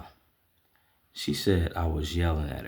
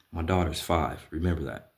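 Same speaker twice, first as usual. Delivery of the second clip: normal talking voice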